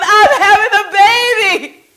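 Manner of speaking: joyful scream. Retold or imitated. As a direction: shout the news to the world. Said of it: "I screamed it out to the world".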